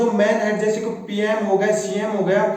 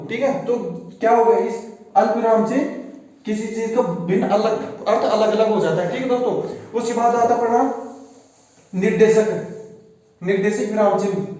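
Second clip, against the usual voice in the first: ordinary talk, nothing marked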